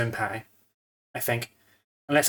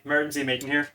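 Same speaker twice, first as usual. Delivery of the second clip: ordinary delivery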